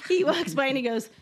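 normal talking voice

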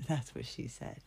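whispered joke aside. Acted. A joke between my husband and myself.

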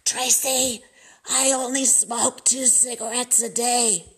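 a hint of grandma's jacked-up voice; grandma's smoker voice. Granddaughter quoting grandma, lightly imitated wrecked smoker's voice. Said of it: "that's how she talked from smoking. Her voice was all jacked up".

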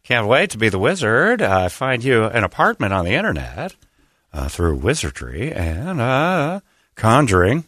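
halting, lilting delivery with pitch swoops; Jeff Goldblum. Acted. Newly cast wizard musing about conjuring, in halting Goldblum voice. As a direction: stretch words out between thoughtful ums and hums. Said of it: "Jeff Goldblum is confirmed to play the Wizard".

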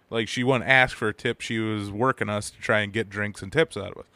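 ordinary talk, nothing marked

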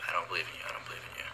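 retelling the dream, pushing Jesus away, a hushed fading refusal. Retold or imitated. Telling how she refused Jesus in her dream, dropping to a whisper.

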